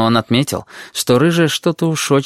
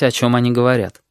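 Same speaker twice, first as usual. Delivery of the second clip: ordinary delivery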